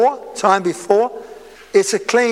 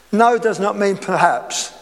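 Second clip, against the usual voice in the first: usual speaking voice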